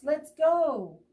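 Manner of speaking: rally the search party; shouting. Acted. Badger leading the search, shouting. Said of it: "and he shouts".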